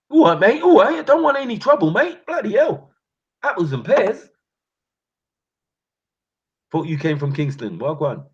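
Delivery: put-on high, loud, frightened Cockney accent; hammed-up scared Cockney bloke backing down; mock Cockney. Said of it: "they'll have you speaking Cockney".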